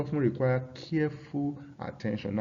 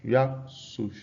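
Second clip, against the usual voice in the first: ordinary delivery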